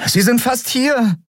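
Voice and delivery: high, anxious warning; pressing, high-pitched alert; urgent warning. A narrator's reading. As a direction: warn with rising urgency. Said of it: "drängte Miko".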